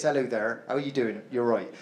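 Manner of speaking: low, soft Dorset greeting; Dorset accent. Shown as a demonstration. Comic showing his lost home accent, low Dorset. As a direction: demonstrate a rural Dorset accent, low in pitch. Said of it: "the Dorset accent is this".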